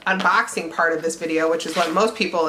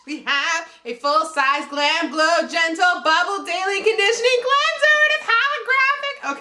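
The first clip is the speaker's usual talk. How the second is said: much higher, rushing through the product name; excited; 6 semitones higher than usual. Real, not acted. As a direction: gush over the cleanser, voice way up. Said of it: "I'm freaking out".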